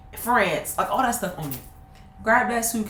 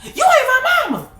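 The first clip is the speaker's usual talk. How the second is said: put-on high loud kid's talkback; high bratty retort; defiant child; 5 dB louder than usual. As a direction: sass back like an angry kid. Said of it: "I was like".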